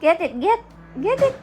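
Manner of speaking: short, very high urging calls. Real, not acted. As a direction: egg a little one on, high and eager. Urging the baby to get it. (masiglang pag-udyok kay baby)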